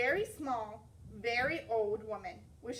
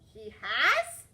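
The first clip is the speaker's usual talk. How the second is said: louder, startled; alarmed question; 8 dB louder than usual. Acted. Girl asks in alarm if the bear has come back. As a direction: ask it in sudden alarm.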